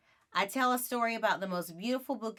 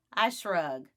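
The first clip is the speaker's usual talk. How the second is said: plain reading voice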